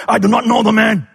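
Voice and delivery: loud, high protest as Peter; vehement denial. Acted. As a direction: deny it forcefully, pitched right up. Peter's acted denial to accusers.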